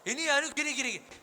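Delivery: high-pitched mimicked grumbling; mock nagging. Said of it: "dia ngomel-ngomel terus".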